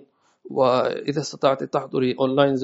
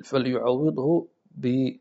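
ordinary talk, nothing marked

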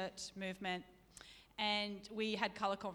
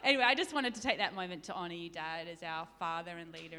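ordinary delivery

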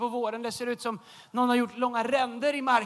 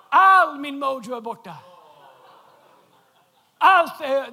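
ordinary delivery

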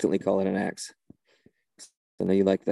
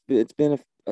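ordinary talk

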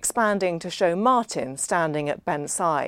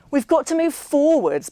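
loud and higher, cutting Millie off; said loudly; 9 semitones higher, 5 dB louder than usual. Acted. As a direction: drown out the table with it. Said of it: "Ben said loudly".

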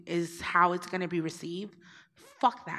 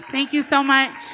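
higher and louder, heartfelt; warm, bright thanks; 7 semitones higher, 9 dB louder than usual. Real, not acted. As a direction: thank her with real gusto. Thanks the questioner, then again louder.

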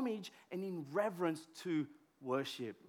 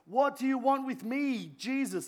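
a raised demon cry; demon shout; 6 semitones higher, 5 dB louder than usual. A narrator's reading. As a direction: shout it up at Jesus. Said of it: "he shouted the top of his voice".

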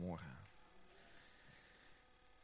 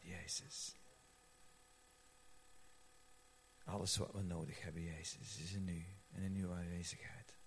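hushed prayer; 4 semitones higher, 6 dB quieter than usual. Real, not acted. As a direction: pray quietly as if Jesus stands beside you.

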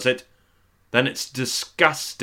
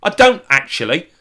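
higher, louder snappy reply; indignant retort; 8 semitones higher, 6 dB louder than usual. Pretend. [name] snaps back at Lulu as an argument erupts. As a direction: bristle and snap back at her. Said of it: "And I'd say".

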